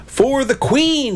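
high, drawn-out rallying cry; booming battle cry. A narrator's reading. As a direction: boom it out slowly as a charge. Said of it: "he boomed".

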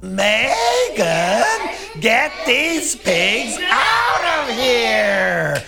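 loud, high bellow, words stretched out; principal's yell. A narrator's reading. Principal orders girl to remove pigs, yelling. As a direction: yell slowly and hugely, dragging out the name. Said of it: "The principal yelled".

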